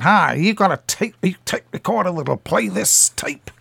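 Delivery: put-on lightly done American movie-star accent. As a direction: imitate an aging Hollywood star asking for help. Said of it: "Do you like the accent, by the way?".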